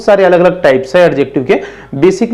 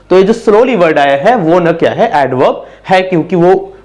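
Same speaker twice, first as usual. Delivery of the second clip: ordinary talk, nothing marked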